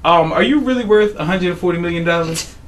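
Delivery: ordinary delivery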